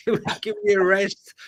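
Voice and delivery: giggling out the punchline; laughing. Real, not acted. Cohost laughing at his own joke about his wife.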